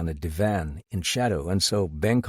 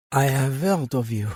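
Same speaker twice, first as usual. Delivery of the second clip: lightly voiced older woman; 5 semitones higher than usual. A narrator's reading. Madame greets detective.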